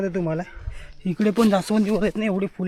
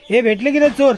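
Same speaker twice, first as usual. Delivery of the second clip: calling out; 6 semitones higher, 9 dB louder than usual. Real, not acted. Vlogger calls out asking kids if the thieves were found, raised. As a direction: shout the question across the yard.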